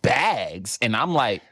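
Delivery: ordinary talk, nothing marked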